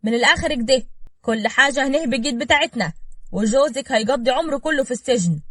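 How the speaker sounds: high, loud, agitated tirade; irritated outburst. A narrator's reading. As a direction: lay down the law, fed up. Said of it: "صفاء قالت بعصبية".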